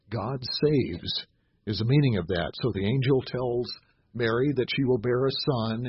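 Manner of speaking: usual speaking voice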